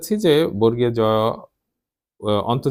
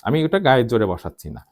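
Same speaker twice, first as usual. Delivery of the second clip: normal talking voice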